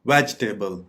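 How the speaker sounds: "वेजिटेबल" said VEJ-tay-bul, no i; correct pronunciation. Shown as a demonstration. Teacher modelling the word, "वेजिटेबल" as VEJ-tay-bul. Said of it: "इसकी दुरुस्त pronunciation है".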